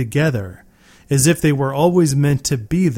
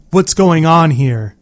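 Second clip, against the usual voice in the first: loud demand; 3 semitones higher, 5 dB louder than usual. A narrator's reading. Demanding answers from father.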